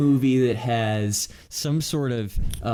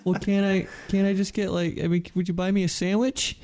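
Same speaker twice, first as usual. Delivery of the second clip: pleading higher voice; 7 semitones higher than usual. Acted. Plea to the Wonka voice.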